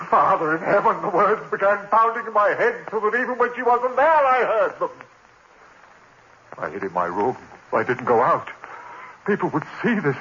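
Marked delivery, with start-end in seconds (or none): 0.1-4.9 anguished cry. Acted. Haunted man crying to heaven about the voices, anguished. Tormented by the words.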